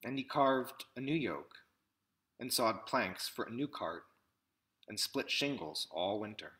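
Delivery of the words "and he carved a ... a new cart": ordinary delivery, nothing marked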